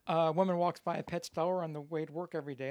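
ordinary delivery

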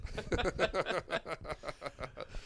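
high, loud burst of laughter; real laugh. Real, not acted. Guest laughing at host's failed accent, high loud laugh. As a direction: crack up helplessly.

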